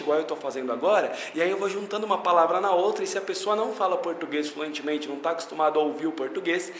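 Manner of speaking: words run together at speed; fast demo of run-together speech; deliberately fast. Shown as a demonstration. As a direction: rush it on purpose, one word into the next. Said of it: "a gente vai falando bem rápido, assim, propositalmente".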